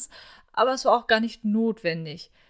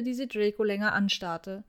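usual speaking voice

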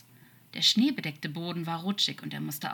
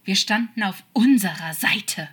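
loud, desperate insistence; urgent, anguished protest; slightly desperate hiss; 4 semitones higher, 10 dB louder than usual. A narrator's reading. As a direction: plead it forcefully, higher and louder. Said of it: "zischte er ihm zu, ein wenig verzweifelt".

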